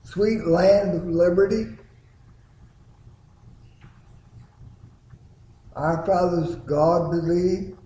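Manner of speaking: stressing the key lines of the song; emphasized words. Shown as a demonstration. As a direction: lean on every phrase of the hymn. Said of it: "I emphasize those particular words".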